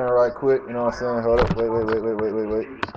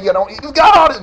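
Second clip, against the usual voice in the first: excited; 10 semitones higher, 8 dB louder, faster than usual. Real, not acted. Hyping friend's Rubik's cube play.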